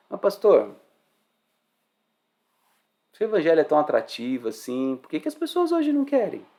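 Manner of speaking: mock objection from the pews; questioning church member.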